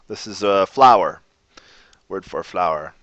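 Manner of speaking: normal talking voice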